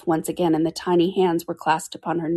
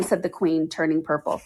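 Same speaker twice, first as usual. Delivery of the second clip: usual speaking voice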